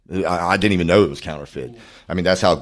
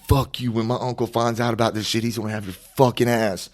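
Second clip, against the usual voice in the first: raised-pitch, defiant threat; 7 semitones higher than usual. Retold or imitated. Suspect threatening cop with his uncle, defiant. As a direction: throw the threat back, pitched high.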